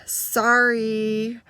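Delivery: long, drawn-out reluctant sorry after a sigh; forced second apology. Retold or imitated. Girl told to mean it this time, drawn-out and reluctant. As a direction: drag the apology out as if made to repeat it. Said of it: "maybe mean it this time".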